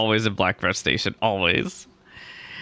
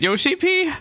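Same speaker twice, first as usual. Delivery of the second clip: the name popped much higher as a joke; squeaky name. Acted. Streamer reading her own character's silly name, squeaky high. Reads the player name in-line.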